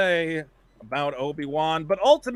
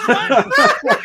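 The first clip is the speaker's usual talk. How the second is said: high, loud, laughing "What?"; a hammed-up startled Obi-Wan; mock shock; 14 semitones higher, 9 dB louder than usual. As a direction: yelp it in parody surprise. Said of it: "And he goes".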